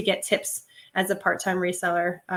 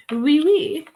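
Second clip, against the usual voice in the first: mock French; 7 semitones higher, 6 dB louder than usual. French brand name, jokes.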